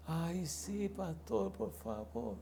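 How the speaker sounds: faint, feeble pleading whimper; depressed friend. Retold or imitated. The depressed friend begs the pastor for help, faint. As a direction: beg weakly, barely there. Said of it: "Le digo".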